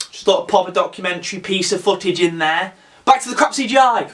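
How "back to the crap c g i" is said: high, fast, loud switch-over; hurried, hammed-up scene change; mock announcing. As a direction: throw it back with comic urgency. Imagined editor switching back.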